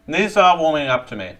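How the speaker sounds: chewing noisily while talking; mouth full. Real, not acted. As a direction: talk through a mouthful of chips. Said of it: "I'm talking with my mouth full".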